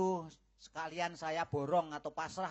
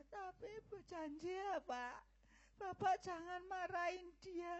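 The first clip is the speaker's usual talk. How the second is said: high, soft, sobbing entreaty; crying plea; 13 semitones higher, 7 dB quieter than usual. Acted. Daughter begs her father not to be angry, crying. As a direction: beg through tears not to hurt him.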